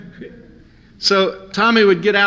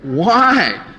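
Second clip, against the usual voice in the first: incredulous why; 4 dB louder than usual. Retold or imitated. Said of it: "And I'm going".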